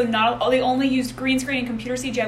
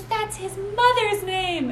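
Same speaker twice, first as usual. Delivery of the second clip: higher, very slow pleading voice; mock dramatic plea; 9 semitones higher, much slower than usual.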